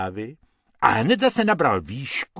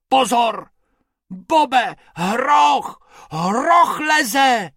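high, loud alarmed yell; panicked rabbit shout; 12 semitones higher, 7 dB louder, much slower than usual. A narrator's reading. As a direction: yell out the hippo warning. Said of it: "křičel Bobek".

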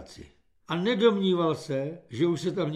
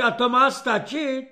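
loud sneering tirade; scornful yell; 7 semitones higher, 7 dB louder than usual. A narrator's reading. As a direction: scold the recruit loudly. Corporal berating recruit.

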